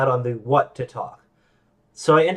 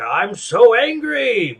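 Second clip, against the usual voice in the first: mock anger; 9 semitones higher, 5 dB louder, much slower than usual. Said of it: "like, a".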